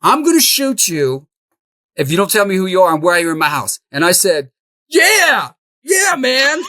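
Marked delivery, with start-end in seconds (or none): 0.0-3.8 retold yell. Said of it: "Randy yells out". 4.9-6.6 high, loud, panicked yeah yeah; scared squeak. Retold or imitated. Answering the stranger on the stairs, a high panicked yelp.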